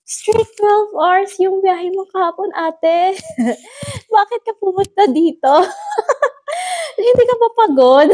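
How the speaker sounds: laughing idol quote. Retold or imitated. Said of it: "yung siya".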